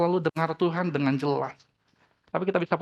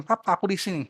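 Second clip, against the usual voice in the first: put-on child calling out; 4 semitones higher than usual. A narrator's reading. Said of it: "Anaknya teriak".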